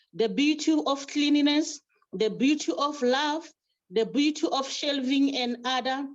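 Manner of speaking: rising, rhythmic declaiming of each line; poem recitation. Acted. Chairperson reciting her library poem to colleagues, declaimed. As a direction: proclaim it like a praise poem at a ceremony.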